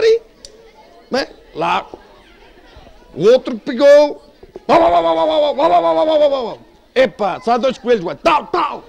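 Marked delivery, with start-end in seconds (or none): 4.7-6.6 man yapping like a dog; dog barking. Pretend. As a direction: bark it out like a hound.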